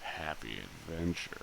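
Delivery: low, soft, half-hearted menacing welcome; badly faked menacing greeter; attempted menace. Shown as a demonstration. Player trying to greet visitors to Happy Adventure menacingly. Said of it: "Wait, no, it's not menacing either".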